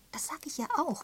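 "Das sag ich ja auch" pitched high, insistently; emphatic. A narrator's reading. Miss Prescott agreeing with Miss Marple, emphatic "Das sag ich ja auch". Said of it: "betonte Miss Prescott".

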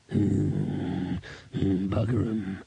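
put-on muttering old beggar; Foul Old Ron. A narrator's reading. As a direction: grumble a curse after drawn-out humming.

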